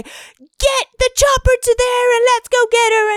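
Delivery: high, bossy rescue order; mock commanding shout. Shouting order as she should.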